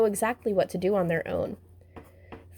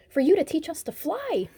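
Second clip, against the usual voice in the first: high, eager mock shout; mild shout; 9 semitones higher than usual. A narrator's reading. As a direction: call it out like excited twins. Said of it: "shouted the twins".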